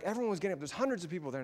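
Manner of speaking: ordinary delivery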